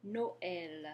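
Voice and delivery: Noël, no then el, said apart; Noël, the vowels separate; French Noël. Shown as a demonstration. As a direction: model Noël for listening. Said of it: "you can hear that the vowels".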